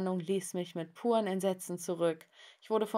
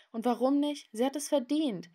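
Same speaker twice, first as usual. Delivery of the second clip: higher-pitched, defiant retort; indignant challenge; 6 semitones higher than usual. Acted. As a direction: push back with raised pitch. Quarrel about his mother. (trotzig und empört)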